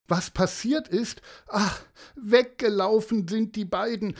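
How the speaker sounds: high, upset mother's voice; distressed mother. Acted. Mother explaining that the children ran away, distressed. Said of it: "sagte die Mutter".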